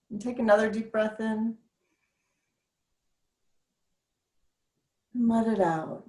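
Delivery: soft, calm breathing guide; gentle meditation voice; guided breathing. Acted.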